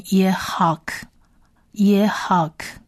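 "Yehak" and "耶哈克" whispered, no voice; whispered demo. Shown as a demonstration. Teacher whispers "耶哈克" so students hear each sound. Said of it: "在这边它这个位置是发音的".

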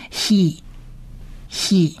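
he pronounced "hee", a breathed h; short I syllable. Shown as a demonstration. Teacher joining kaf and vowel for learners, isolated "hee" for he. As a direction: sound out he twice, long pause between. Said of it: "这个是一短一的音".

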